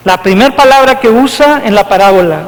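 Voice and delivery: normal talking voice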